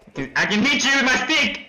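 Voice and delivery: high, frantic threat; panicked threat. Real, not acted. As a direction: yell a desperate warning at the attacker.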